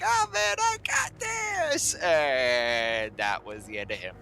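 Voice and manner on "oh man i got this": extremely high, slow squeal; the tiny tick's last brave squeak; doomed tick boasting in an extremely high squeal; mock squeaky tick. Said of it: "He's like".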